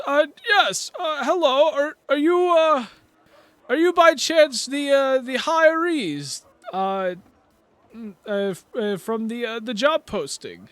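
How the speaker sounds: high, loud, slow hesitant question; curious chairman. Acted. Chairman asking the hirees if they answered the posting, high and hesitant. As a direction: ask them kindly, fumbling the words. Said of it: "he goes".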